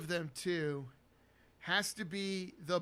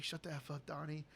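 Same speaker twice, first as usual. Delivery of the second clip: low, soft shushing scold; hushed telling-off; 4 semitones lower, 10 dB quieter than usual. Retold or imitated. Walter hushing Donnie in the auditorium, quiet scold. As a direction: keep it down but firm. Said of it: "He's like".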